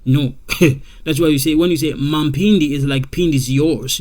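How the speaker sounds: normal talking voice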